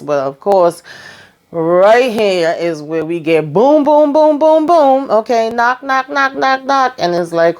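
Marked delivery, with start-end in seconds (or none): 3.5-5.1 high, punchy booming noises; imitated door banging. Recapper imitates someone banging at the door, high vocal booms. 5.5-6.9 high, even run of knocks; imitated knocking. Recapper imitates Sharon knocking at the door, high repeated knocks.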